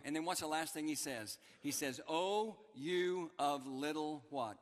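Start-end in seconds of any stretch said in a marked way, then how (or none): 2.1-4.6 drawn-out prompt. Acted. Preacher invites congregation to finish.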